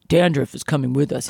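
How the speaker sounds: thug line in a deep voice; deep, heavy tough-guy read. Retold or imitated. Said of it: "he says in a deep voice".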